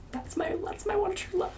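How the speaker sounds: very high, squeaky near-tearful voice; choked up. Real, not acted. Reader quoting Haymitch on Lenore, choking up. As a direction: squeeze it out high as emotion takes over.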